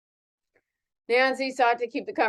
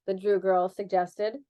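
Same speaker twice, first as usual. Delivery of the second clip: ordinary delivery, nothing marked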